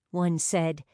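usual speaking voice

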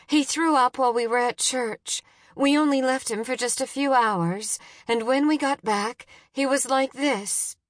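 high, urgent explanation; frantic mother on an emergency call; desperate pleading. A narrator's reading.

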